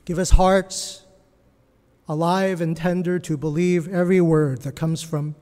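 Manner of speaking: a pastor praying over his church, earnest; prayer. Real, not acted. Pastor prays for congregation.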